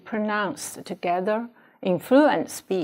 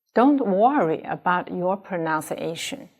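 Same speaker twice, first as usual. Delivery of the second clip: normal talking voice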